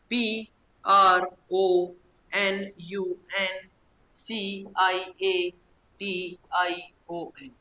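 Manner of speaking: slow spelling, "पी आर ओ", "एन यू एन", "सी आई ए", "टी आई ओ एन"; in chunks. Shown as a demonstration. Teacher drilling pupils on memorising, slow separate chunks. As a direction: pause after each letter group, every letter drawn out. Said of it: "टुकड़ों में".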